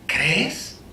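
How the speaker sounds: pregunta susurrada, íntima; susúrralo como una pregunta al oído; susurro. Retold or imitated. ("¿Crees?" susurrado, casi sin voz)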